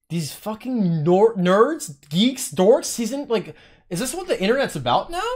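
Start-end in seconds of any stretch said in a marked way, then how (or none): 0.1-3.0 louder, slow, hammering each insult; angry rant. Real, not acted. Streamer raging at online nerds, a slow angry rant. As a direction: bang out every insult.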